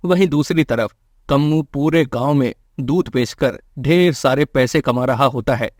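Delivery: ordinary delivery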